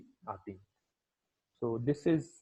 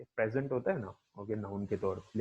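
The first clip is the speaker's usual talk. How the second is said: ordinary talk, nothing marked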